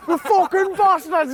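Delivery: high, slow furious cry; retold yell, pitched high and drawn out; mock angry yell. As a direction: play the fishermen roaring up the hill. Angry fishermen chasing, retold.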